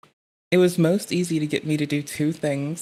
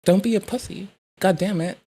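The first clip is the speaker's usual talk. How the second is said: imitating the clip guy; 3 semitones higher than usual. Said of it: "evoke that man saying".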